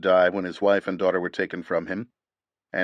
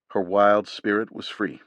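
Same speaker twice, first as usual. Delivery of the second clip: ordinary delivery, nothing marked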